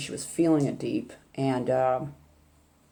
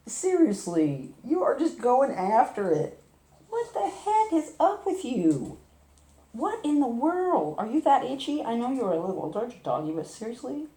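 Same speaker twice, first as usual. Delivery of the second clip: scolding the dog; 8 semitones higher than usual. Acted. Owner scolds her scratching dog in a high pet voice.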